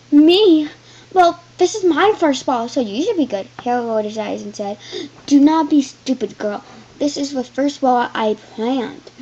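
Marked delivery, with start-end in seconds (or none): 0.1-3.5 high, loud indignant cry; loud childlike protest. Acted. Quarrel over the ball. 5.3-9.1 angry. Acted. Husband insulting wife.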